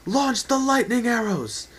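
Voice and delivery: battle captain's bellow; shouted order. Acted. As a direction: fire the volley by your order. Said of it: "Spartan Shield shouted".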